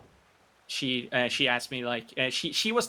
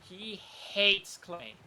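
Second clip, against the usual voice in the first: slow, higher, with hates drawn out and hit hard; stress on hates; 6 semitones higher, much slower than usual. Real, not acted. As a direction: lean on hates, slowly and higher. Repeating the key point.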